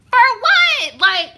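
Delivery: high, loud disbelieving yell; incredulous outburst. Real, not acted. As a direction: burst out loud and high, appalled. Outraged outburst while reading viewer's story.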